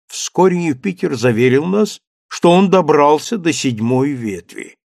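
plain reading voice

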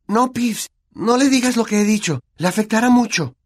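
ordinary delivery

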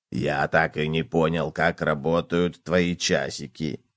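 low, soft, musing voice; pensive cockroach. A narrator's reading. Cockroach asks gopher about his watch, pensive. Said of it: "задумчиво раскачиваясь на стуле".

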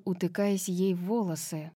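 ordinary delivery

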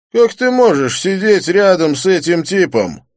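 high, loud, threatening challenge; menacing. A narrator's reading. The blond thug threatens the narrator, menacing. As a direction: lean in and threaten. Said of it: "угрожающе навис".

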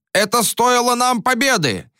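high furious yell. A narrator's reading. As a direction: bellow it at the crowd. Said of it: "орал Боре".

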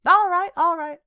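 high, loud playful give-up voice; fake surrender. A narrator's reading. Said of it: "fake surrender".

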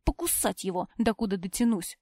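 ordinary delivery

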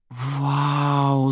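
long, drawn-out awed gasp of a word; marvel, stretching the vowel; spellbound. A narrator's reading. Bonnie reacts to Zaira's birthday boast, spellbound. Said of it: "заворожённо произнесла Бонни".

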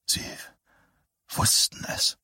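breathless horrified whisper. Acted. Man staring at the stricken woman, horrified whisper. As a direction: breathe the words, no voice. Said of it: "flüsterte er".

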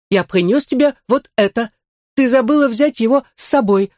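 lightly voiced android. Android Max speaks. (голос андроида)

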